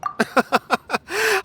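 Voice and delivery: high, airy amused chuckle; amused laugh. Acted. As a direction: laugh lightly at their silence. Amused at the unanswered question.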